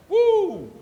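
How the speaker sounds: high, loud joyful whoop; preacher's exultant woo; excited whoop. Real, not acted. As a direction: let out a shout of delight. Excited exclamation between quotes.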